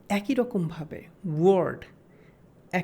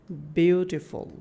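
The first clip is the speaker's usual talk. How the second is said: careful example word; 3 semitones lower, slower than usual. Shown as a demonstration. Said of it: "যেমন".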